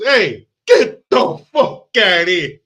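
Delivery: high, loud, slow bad Italian accent; Steven Seagal. Detective opening trunk to release a guy, Italian-accent yell. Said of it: "he goes".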